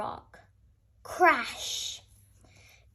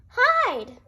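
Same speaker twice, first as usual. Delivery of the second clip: much higher and louder urgent cry; cry of alarm; 10 semitones higher, 8 dB louder than usual. A narrator's reading. Owl warning the animals to hide, alarmed cry. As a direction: shriek a warning to the others. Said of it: "cried Owl".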